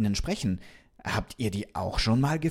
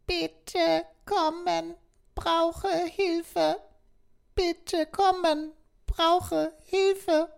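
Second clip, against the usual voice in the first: very high, slow robotic distress call; a stranded little robot voice radioing for help; much slower than usual. Acted. Broken doll calling out for rescue, very high and robotic. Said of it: "das klang nach einem Roboter".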